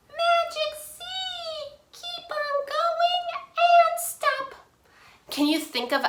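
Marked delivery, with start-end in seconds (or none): 0.1-4.5 much higher squeaky chant, slowed. Shown as a demonstration. Teacher demoing silly voice for kids, squeaky high. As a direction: squeak the rhyme like a mouse. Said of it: "Squeaky voice".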